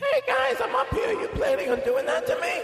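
squeaky talk-show host voice; high-pitched Letterman voice. Pretend. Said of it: "You don't think Letterman would air".

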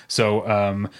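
ordinary delivery

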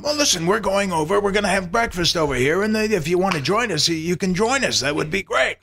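Mel Brooks. Retold or imitated. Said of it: "So I said".

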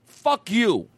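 high parody loudmouth local; over-the-top provincial taco partisan; stubborn local. Acted. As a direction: argue tacos with hammed-up certainty. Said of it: "so they'll be like".

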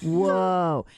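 drawn-out stoner "whoa"; slack, amazed stoner voice; Cheech and Chong. Retold or imitated. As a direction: imitate a dazed stoner. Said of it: "I'm watching Cheech and Chong".

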